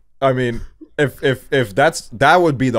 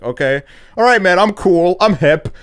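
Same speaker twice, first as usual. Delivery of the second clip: higher, louder, playing it cool; mock cool; 9 semitones higher, 6 dB louder than usual. To a famous YouTuber, pretending to be cool, higher and louder. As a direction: overdo acting hip and relaxed.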